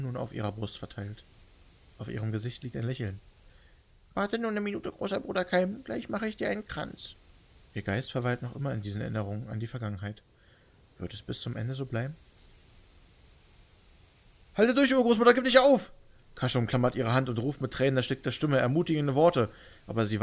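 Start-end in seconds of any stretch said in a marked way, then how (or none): 4.1-7.2 put-on gentle little-girl voice; childlike dying woman. 14.5-15.9 high, loud, pleading cry; desperate plea. Acted. Begging dying great-grandmother.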